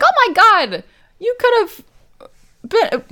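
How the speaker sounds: high, loud acted-out shock; hammed-up high-pitched alarm; shocked outburst. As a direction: gasp it high, imitating her fright. Joan shocked by the prank.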